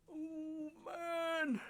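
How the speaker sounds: soft, very high, long-held whine; keen it quietly, high and stretched; thin drawn-out lament; despairing wail. Real, not acted. Despair over lost footage.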